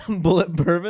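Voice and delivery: loud, laughing echo of the odd name; laughing disbelief. Real, not acted. Listener echoes the odd drink name.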